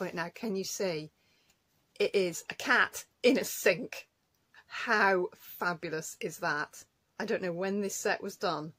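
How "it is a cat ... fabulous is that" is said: delighted. Real, not acted. Delighted at cat stamp.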